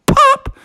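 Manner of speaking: very high, sudden "pop"; short high-pitched sound effect; popping noise. Acted. Imitates keyhole popping open.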